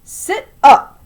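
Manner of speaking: loud shouted order. A narrator's reading. Grandma shouting at the boy, a loud command. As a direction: shout it like training a dog. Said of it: "she'd shout".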